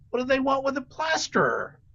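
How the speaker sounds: confused duck. Acted. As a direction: land the punchline as a baffled duck.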